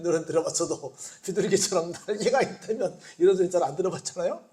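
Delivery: usual speaking voice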